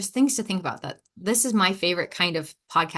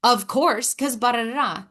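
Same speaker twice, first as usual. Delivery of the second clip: defensive comeback; 4 semitones higher than usual. Acted. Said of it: "your immediate answer is".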